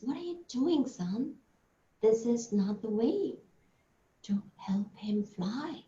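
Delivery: soft, near-whispered gentle scolding; hushed mother. Acted. Mother quietly tells her son he's doing it wrong, whispered. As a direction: hush him, don't wake the bird.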